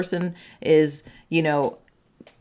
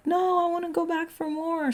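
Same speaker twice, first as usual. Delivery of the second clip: whiny protest; 12 semitones higher than usual. Acted. You refusing Spirit's call to come away, whiny protest. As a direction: whine it up high like a reluctant kid.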